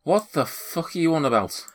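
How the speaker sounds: high-pitched, baffled outrage; incredulous, high-voiced protest; indignant homeowner. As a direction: snap back at a raving intruder. Confronted man answers the intruders.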